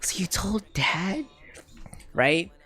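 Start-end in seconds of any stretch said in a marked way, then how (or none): 0.0-1.2 high, slow whispered question; scared. Retold or imitated. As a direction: whisper it as if dreading the answer. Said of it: "I was scared, dude".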